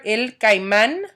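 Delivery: el caimán, slow, with the last A stressed; stress on last syllable. Shown as a demonstration. Teacher modelling alligator in Spanish, caimán stressed on last A. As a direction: draw out the final A of caimán. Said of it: "which has an accent over the last A".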